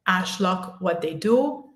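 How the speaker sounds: normal talking voice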